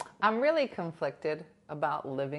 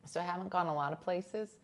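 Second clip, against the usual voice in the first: usual speaking voice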